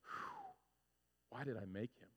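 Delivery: a weary creator wondering aloud, hushed; God sighing. Retold or imitated. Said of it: "somebody looks down and goes".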